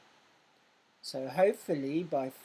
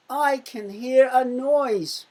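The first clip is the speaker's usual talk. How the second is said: higher, louder, slow and dramatic; excited story line; 7 semitones higher, 8 dB louder, slower than usual. Acted. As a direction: read it like a thrilling storybook moment. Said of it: "Do you remember that from the story".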